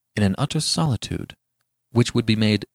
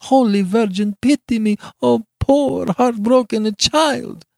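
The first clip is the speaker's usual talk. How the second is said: very high, loud wail; heartbroken plea; 13 semitones higher, 5 dB louder than usual. A narrator's reading. Beatrice praying to the Virgin, heartbroken. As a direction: pray for pity in high anguish. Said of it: "with a low moan out of her heart".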